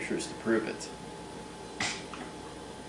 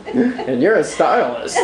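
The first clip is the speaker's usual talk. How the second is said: laughing; 9 semitones higher, 6 dB louder, slower than usual. Real, not acted. Laughing at the stylist mom.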